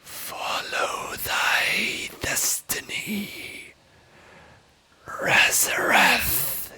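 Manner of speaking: slow, breathy voiceless whisper; eerie whisper. Acted. A voice in Razareth's mind whispering his destiny. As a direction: hiss it slowly like a voice in the mind. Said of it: "you just hear the whisper".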